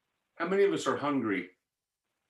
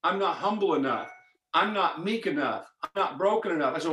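usual speaking voice

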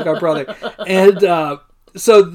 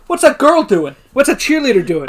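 higher, lightly voiced indignant father; indignant dad; 7 semitones higher, 4 dB louder than usual. Spike's dad objecting to a cheerleader playing, mock indignation. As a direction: question it with raised pitch, mildly outraged.

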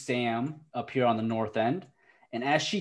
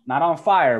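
ordinary delivery

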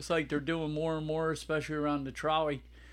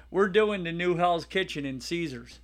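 ordinary talk, nothing marked

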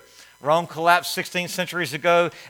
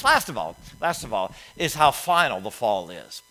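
ordinary talk

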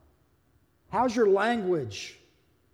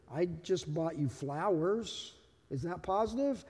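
much softer, timid questioning; meek rebuttal; 5 semitones lower, 10 dB quieter than usual. Acted. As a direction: answer a spouse's accusation in a small voice. Acted meek reply to accusation.

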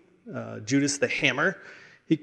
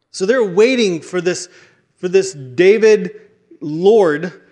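louder, higher, driving sermon voice; preaching at full force; 4 semitones higher, 5 dB louder than usual. Acted. Preacher rousing the congregation about the coming king, full force.

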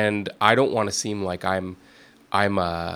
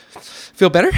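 put-on high, loud little-kid voice; piping up like a toddler checking in; squeaky child asking sweetly; daughter's cute question; 9 semitones higher, 10 dB louder, slower than usual.

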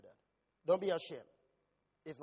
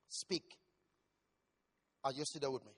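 ordinary talk

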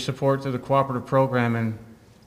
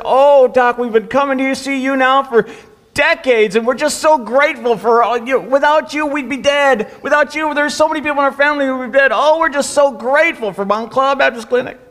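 higher, louder gushing thanks; grateful patients; 10 semitones higher, 9 dB louder than usual. Retold or imitated. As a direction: gush with gratitude like a devoted patient.

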